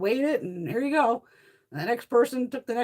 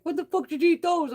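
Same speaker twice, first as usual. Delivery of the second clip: high, loud mock outrage from the husband; shocked husband; 9 semitones higher, 4 dB louder than usual. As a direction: play the husband catching her out.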